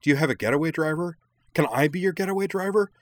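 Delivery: high, breakneck eager pleading; giddy excitement. A narrator's reading. Minami begs to be the getaway driver, giddy excitement. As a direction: beg to join, tumbling over the questions. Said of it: "getting more and more excited".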